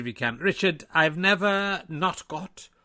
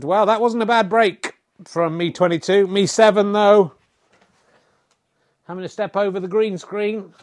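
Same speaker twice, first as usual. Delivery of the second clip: louder commentator voice calling the shots; lively snooker commentator; sports commentary; 4 semitones higher, 8 dB louder than usual. Acted. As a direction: call the snooker play like a TV commentator.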